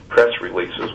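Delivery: normal talking voice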